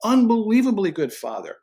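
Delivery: high, bright-hearted voice of God, encouraging; voicing God. God telling a doubting dad he is good, in a high voice. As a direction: speak as God lifting up a discouraged father. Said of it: "letting the Lord look at you and go".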